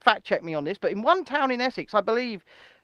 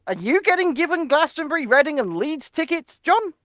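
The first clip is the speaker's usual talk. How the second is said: indignant; 7 semitones higher, 3 dB louder than usual. Real, not acted. Indignant question to John.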